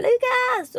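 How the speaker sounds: high-pitched call of a name. Acted. Parent calling her child from the audience as he passes, calling out. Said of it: "så jag ropar".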